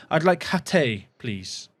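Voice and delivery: a mock French accent, ordering politely; amateur French accent, still being worked on. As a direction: playfully put on a French accent to ask for it. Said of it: "That's my French accent".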